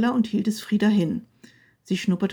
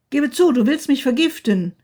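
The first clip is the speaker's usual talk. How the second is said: loud, higher booming accusation; booming shout; 4 semitones higher, 6 dB louder than usual. A narrator's reading. Husband booms accusation at wife through garden. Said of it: "Seine Stimme dröhnte durch den Garten".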